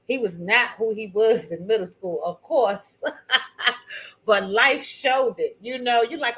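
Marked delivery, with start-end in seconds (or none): 3.1-4.2 amused chuckle mid-story; short laugh. Real, not acted. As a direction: laugh briefly at your own remark. Laughs at her own story.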